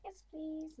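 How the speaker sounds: put-on high little kid; polite child voice. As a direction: pipe it up like a small child.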